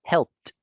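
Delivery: "helped" ending in a crisp T sound; "helped" clipped to a final T. Shown as a demonstration. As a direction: say "helped" as one syllable, helpt. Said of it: "pronunciarlo con una T".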